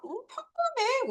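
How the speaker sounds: mock casual answer about a friend; acted shrug. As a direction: toss it off casually. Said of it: "우리가 요런 말을 쓰죠".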